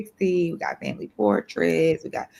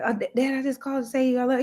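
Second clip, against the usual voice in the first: son phoning dad; 7 semitones higher, faster than usual. Retold or imitated. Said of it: "He was like".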